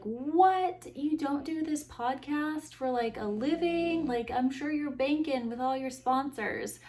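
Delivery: high-pitched, incredulous outburst; mock shock. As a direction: sarcastically play the astonished fan. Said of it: "you are shocked".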